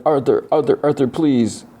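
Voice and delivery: high-pitched mock pleading; tearful pleading. Boy begs dead man to respond, acted tearful plea. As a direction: plead high, imitating someone upset without real sobs. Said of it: "he starts, like, crying".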